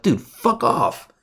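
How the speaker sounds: high, drawn-out "Dude, fuck off"; recalling giving up on a chart, high and slow; retold exasperation. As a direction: stretch it out in fed-up disgust. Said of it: "And I was like".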